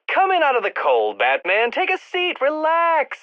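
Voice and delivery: high-pitched sarcastic welcome; mocking invitation. Villain telling Batman to relax, mocking invitation. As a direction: call him in with exaggerated hospitality.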